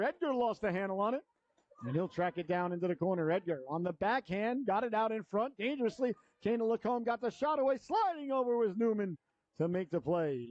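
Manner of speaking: usual speaking voice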